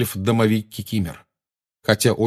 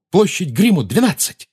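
shouting; 10 semitones higher, 4 dB louder than usual. A narrator's reading. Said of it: "и крикнул".

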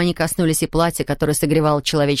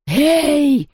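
high, menacing call; menacing ghostly shout; threatening; 8 semitones higher, 4 dB louder than usual. A narrator's reading. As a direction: call out sharply higher, as a threat. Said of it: "угрожающе прошипела она".

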